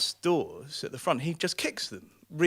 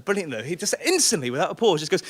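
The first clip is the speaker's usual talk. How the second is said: normal talking voice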